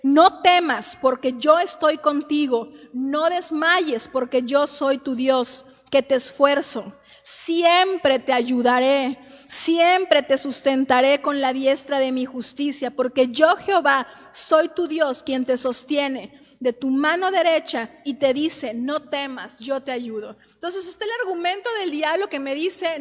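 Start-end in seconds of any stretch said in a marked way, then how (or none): 0.0-1.0 No temas proclaimed louder and drawn out, NO hit hard; loud proclamation. Acted. Wife preaching a verse to her husband, loud proclamation. 1.0-18.7 slow, louder preached verse, each yo hammered; preaching at full force. Acted. Wife preaching God's promise to her husband, slow and louder. 18.7-20.3 gentle reassurance. Acted. Gentle close of the quoted verse.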